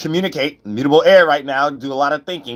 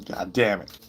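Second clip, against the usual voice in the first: ordinary talk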